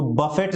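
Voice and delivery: "बफेट" with "buh" first and the final t sounded; wrong pronunciation. Shown as a demonstration. Teacher warning learners off a misreading, "बफेट" with audible final t. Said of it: "नहीं पढ़ते हैं".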